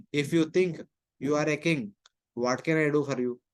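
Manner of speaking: ordinary delivery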